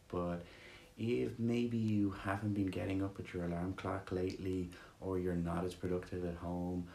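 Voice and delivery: soothing guided meditation read. Acted. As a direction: ease them gently into stillness.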